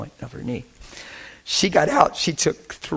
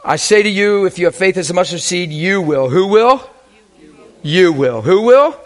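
loud, high call to the congregation; preaching at full force; 5 semitones higher, 7 dB louder than usual. Acted. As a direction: fire the question at the crowd.

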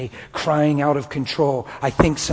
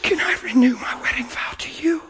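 tearful whisper; 10 semitones higher than usual. Retold or imitated. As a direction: ask it in a hushed, broken voice. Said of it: "And I said".

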